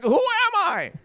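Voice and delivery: very high, wailed question; mock anguished cry. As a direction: cry out in a hammed-up identity crisis. Mock identity crisis.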